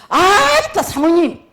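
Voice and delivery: loud, high Jeolla-dialect greeting, 아따 flung out; Jeolla-dialect hail shouted across the street; Jeolla dialect call. Retold or imitated. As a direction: call out in broad Jeolla dialect like a cheeky friend. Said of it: "그걸 흉내내다가 나 사투리를 써버렸어요".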